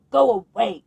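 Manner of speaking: loud gruff shout. Acted. Lonely old man shouting at the squirrels, loud. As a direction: push them off with your voice. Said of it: "shouted the old man".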